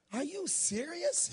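high, drawn-out incredulous question; retold disbelief. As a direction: stretch it out in disbelief. Retold incredulous reaction to listeners.

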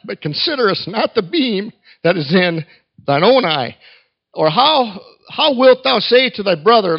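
measured, emphatic King James reading; Scripture reading. Acted. Preacher reads Matthew seven to the congregation, proclaiming. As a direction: proclaim the verses with weight.

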